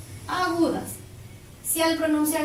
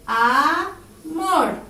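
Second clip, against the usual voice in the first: "Amor" pulled apart as A, MOR, with a gap; slow "Amor", long A, then a strong MOR; split into syllables; 4 dB louder than usual. Shown as a demonstration. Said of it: "Se carga la voz". (amor separado en sílabas, cargando MOR)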